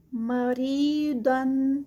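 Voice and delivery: مریدن with ری held two counts and heavy د; two-count stretch. Shown as a demonstration. Teacher modelling the stretch in مریدن. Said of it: "ری پہ ٹو counts".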